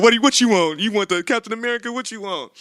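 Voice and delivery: high, eager, hammed-up pleading to a child; high loud doting voice. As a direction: play the softie dad giving in. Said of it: "himself caving to his son".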